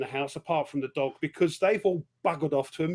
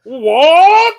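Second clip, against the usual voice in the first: much higher, loud, laughing bellow of outrage; Harry Secombe's bellow; 12 semitones higher, 16 dB louder than usual. Retold or imitated. Playing the workhouse master refusing more, a laughing comic roar. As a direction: explode with a big comic roar. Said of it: "And then Harry Seacombe goes".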